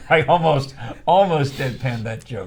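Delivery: laughing through the words, loud and high. Real, not acted. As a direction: crack up while admitting it. Laughing at own failed joke.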